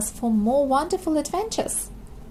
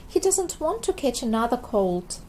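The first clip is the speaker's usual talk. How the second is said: ordinary delivery, nothing marked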